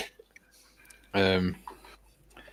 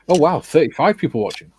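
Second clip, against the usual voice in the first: excited; 8 semitones higher, 8 dB louder than usual. Real, not acted. Excited at viewer count.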